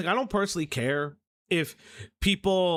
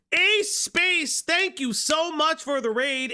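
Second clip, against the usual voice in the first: excited greeting; 8 semitones higher, slower than usual. Real, not acted. Streamer greets raider.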